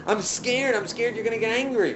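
loud, high, mock-scared protest; hammed-up protest. Visitor baiting Hillel about anger, hammed-up protest.

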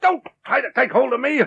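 high, furious threat; angry outburst. Acted. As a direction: lash back in rage. Kendall insulted, furious.

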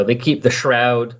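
normal talking voice

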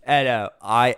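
imitation Australian accent; mock Australian accent. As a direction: playfully lay on an Australian accent. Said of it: "Pretty good accent, by the way".

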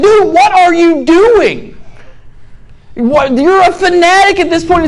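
a hint of a stunned neighbor; baffled neighbors. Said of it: "They're like".

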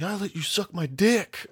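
high-pitched betrayed protest; mock outrage. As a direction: squeal it like a cheated kid. Aggrieved kid accusing his friend.

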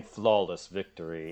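low, slow game announcer villain; Shang Tsung. Acted. Shang Tsung after the room is clean, low slow villain voice. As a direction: pronounce the win slowly in a deep voice. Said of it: "here's Shang Tsung saying".

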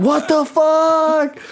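very high, drawn-out comic cry; mock panicked wail. Retelling his panic, still peeing.

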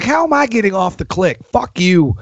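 imitating his old fury at the machine; mad at the click. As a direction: yell at the click track. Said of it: "it would make me so mad".

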